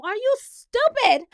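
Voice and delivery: high, loud, slow disbelief; incredulous scolding. Acted. Host asking Wes why he threatened everyone, incredulous. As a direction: stretch out the outraged question.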